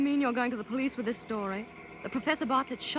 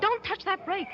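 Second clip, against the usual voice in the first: alarmed shout; 8 semitones higher than usual. Acted. Struggle over the car brake.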